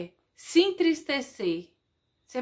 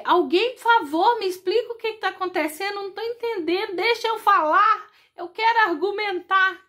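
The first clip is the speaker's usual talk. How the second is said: loud, much higher, rushed pleading; desperate plea; 8 semitones higher, 6 dB louder than usual. Acted. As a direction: beg to be heard, fast and urgent. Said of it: "é uma coisa assim".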